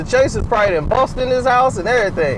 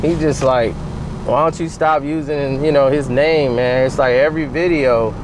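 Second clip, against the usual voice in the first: much lower, laid-back messenger voice; a much lower, mellow messenger; James Jones impression; 10 semitones lower than usual. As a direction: drop way down and play it easy. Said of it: "He gonna send James Jones".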